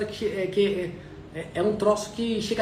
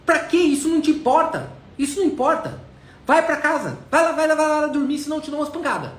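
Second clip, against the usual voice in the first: father's angry yell, much higher and louder; angry shout; 7 semitones higher, 5 dB louder than usual. Retold or imitated. Tired father yelling at his son to go to bed, angry shout. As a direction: bark it at the pestering kid like a worn-out dad.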